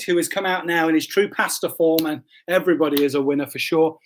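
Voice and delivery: normal talking voice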